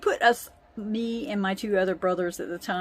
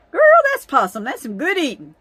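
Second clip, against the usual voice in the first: put-on much higher, louder, proud old grandpa; grandpa's voice; 4 semitones higher, 8 dB louder, faster than usual. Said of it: "And he's like".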